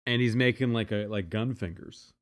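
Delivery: ordinary talk, nothing marked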